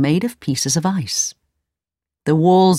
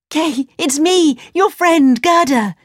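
put-on high little-girl voice; urgent girl's appeal; 9 semitones higher, 3 dB louder than usual. A narrator's reading. Gerda tells Kay who she is, urgent appeal. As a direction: make him recognise you, high and drawn out. Girl reveals herself to lost friend.

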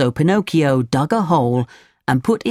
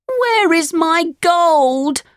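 put-on high, drawn-out puppet-boy wail; upset Pinocchio crying out; angry cry; much slower than usual. A narrator's reading. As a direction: demand it way up high, stretched out. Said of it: "he cried".

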